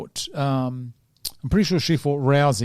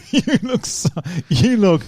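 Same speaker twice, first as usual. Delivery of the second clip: laughing; 3 semitones higher, slower than usual. Real, not acted. Host teasing his unimpressed co-host, laughing. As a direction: crack up while teasing. Cracking up at co-host's unimpressed look.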